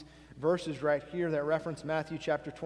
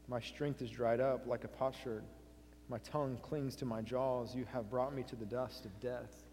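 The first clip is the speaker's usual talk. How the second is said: subdued suffering; 3 semitones lower, 6 dB quieter than usual. Acted. Reads the psalm of suffering.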